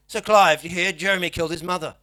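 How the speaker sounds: loud agitated Aussie. Retold or imitated. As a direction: blurt out the gossip loudly. Steve's agitated Aussie self.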